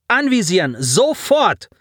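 high, forceful order; yelled command. A narrator's reading. Commander to tactics, yelling to take aim. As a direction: bark it at your crew. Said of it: "brüllte sie die Taktik an".

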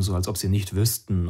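ordinary delivery, nothing marked

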